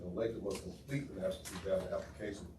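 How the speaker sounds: ordinary delivery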